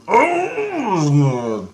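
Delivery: long, drawn-out groan of anger; frustrated groan. Retold or imitated. Recalling the maddening dream, long frustrated groan. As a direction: let out an exasperated, stretched groan. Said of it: "And I was so mad".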